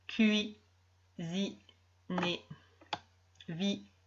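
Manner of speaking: slow, clear reading of each word; careful word reading. Shown as a demonstration. Teacher reading a list of words to a young pupil. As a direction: read every word out slowly for a beginner.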